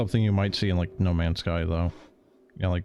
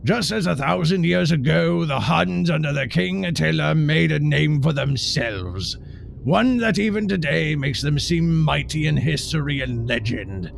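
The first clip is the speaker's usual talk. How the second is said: rousing war speech; 6 semitones higher, 4 dB louder than usual. Retold or imitated. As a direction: thunder it like an emperor rallying troops. Quoting the Kaiser's war speech.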